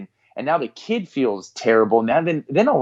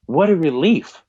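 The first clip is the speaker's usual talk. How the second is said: higher-pitched retold tearful relief; 5 semitones higher than usual. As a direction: imitate a crying son letting out his relief. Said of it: "just starts crying".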